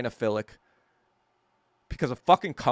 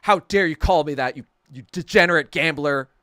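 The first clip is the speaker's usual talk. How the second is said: mock outrage, louder and emphatic; hammed-up angry comeback; angry retort; 2 semitones higher, 5 dB louder than usual. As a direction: imitate an indignant accuser. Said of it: "he got so angry".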